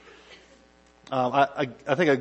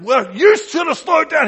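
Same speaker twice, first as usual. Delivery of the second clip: high, loud indignant retort; the other driver firing back in a high, loud retort; firing one back; 10 dB louder than usual. Pretend. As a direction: snap back hard at him, much higher and louder. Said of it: "he's gonna like".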